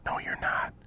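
whispered contradiction; hushed off-mic retort; whispering. Real, not acted. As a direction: contradict them under cover of a whisper. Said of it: "You're whispering".

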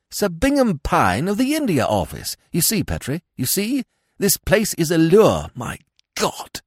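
urgent realization, building towards an appalled outburst; urgent alarm. A narrator's reading. Smith tells Petrie who is below, urgent and agitated.

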